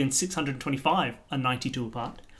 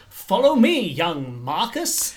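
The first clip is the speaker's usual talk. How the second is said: spy mentor voice; 3 semitones higher, much slower than usual. Acted. Leader voicing his character.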